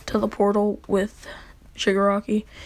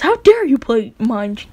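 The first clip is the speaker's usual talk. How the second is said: louder indignant accusation; hammed-up offended tsundere; mock outrage; 6 dB louder than usual. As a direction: accuse him in acted outrage. Acted outrage in retold quarrel.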